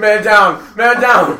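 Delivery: loud, high shout; mock alarm call. Friend announces Chris collapsing with laughter, mock alarm shout. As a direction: call it out like a battlefield casualty, in fun. Calls out as friend falls over.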